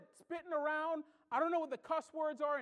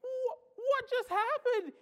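high, loud, stammering shock; can't believe what he just saw; stunned disbelief; 9 semitones higher, 3 dB louder, slower than usual. Pretend. Onlooker watching the proposal fail, high shocked disbelief. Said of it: "and you're like".

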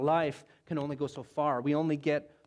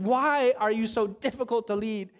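louder, fed-up question; frustrated complaint; 4 semitones higher, 4 dB louder than usual. Acted. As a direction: throw up your hands at the people. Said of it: "So Moses is saying to them".

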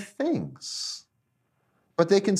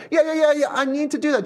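much higher, rushed mock assent; hasty agreement; 11 semitones higher, 4 dB louder, much faster than usual. Churchgoer answering the message, hasty mock agreement. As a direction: agree too readily, brushing it through. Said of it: "it's like".

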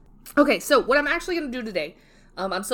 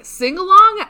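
excited; 10 semitones higher, 12 dB louder, slower than usual. Retold or imitated. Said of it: "immediately you're like".

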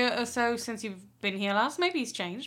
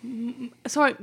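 ordinary talk, nothing marked

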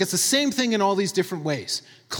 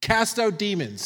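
normal talking voice

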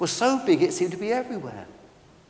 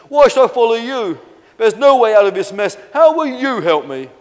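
higher, loud challenge; doubting protest; 5 semitones higher, 11 dB louder than usual. Acted. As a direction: challenge the would-be rescuer loudly. Doubting dinosaur challenges rescuer.

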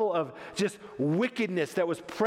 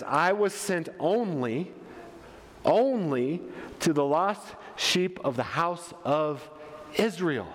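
measured delivery with long pauses; ONLY drawn out and repeated after a pause; slow, deliberate quote; 2 semitones lower, slower than usual. Acted. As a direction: let each phrase land separately. Quotes Jesus's answer weightily.

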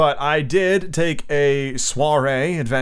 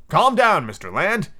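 raised pitch, firm call for order; commanding character line; 8 semitones higher than usual. Acted. Stranger interrupts Ned Land's attack, commanding call. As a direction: cut in on a scuffle, higher voice.

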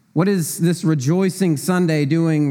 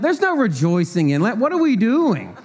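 higher-pitched, playful exasperation; mock indignation; 7 semitones higher than usual. Preacher echoing the movie coach about Lent, mock-indignant. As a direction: protest like a baffled coach, half in jest.

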